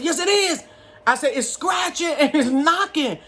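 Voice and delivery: put-on high, pleading little-girl voice, slowed; frightened child begging her mother to believe her; scared child. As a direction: insist like a frightened kid.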